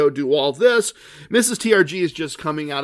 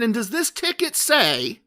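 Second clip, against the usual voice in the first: Cousin Vinny; 6 semitones higher than usual. Retold or imitated. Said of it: "I'm doing my best Cousin Vinny here".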